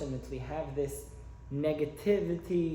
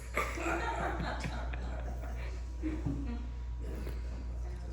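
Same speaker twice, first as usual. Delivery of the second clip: soft airy laugh; 10 semitones higher, 10 dB quieter than usual. Real, not acted. Laughing at own punchline.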